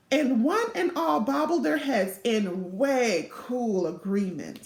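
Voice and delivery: ordinary delivery, nothing marked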